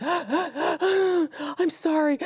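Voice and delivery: high, drawn-out, slow sobbing apology; a broken, wailing, slow I'm sorry; retold sobbing. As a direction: imitate a woman crying too hard to speak. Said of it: "she was just crying out of control".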